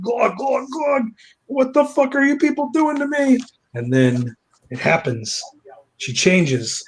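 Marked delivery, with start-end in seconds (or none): 0.0-1.0 high choked glugging noises; mock gagging glugs. Susie with monsters down her throat, mock choking. 1.5-3.4 high-pitched horrified protest; mock distressed cry. Susie protesting to her attackers, mock distressed.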